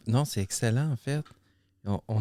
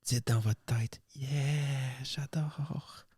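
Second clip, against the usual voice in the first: library whisper; 3 semitones higher, 3 dB quieter, much slower than usual. Acted. ASMR whisper.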